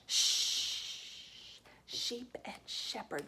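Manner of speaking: unvoiced, whispered "Shh" then "Sheep and shepherd" said slowly; whispered sh demo. Shown as a demonstration. Teacher modelling "sh" words for pupils in a slow whisper. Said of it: "S, H".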